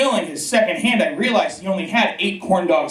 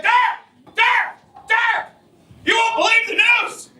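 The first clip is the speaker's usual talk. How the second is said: high, excited shouting; clickbait video narrator. Retold or imitated. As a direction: yell the news out, thrilled. Excited buddy calling out.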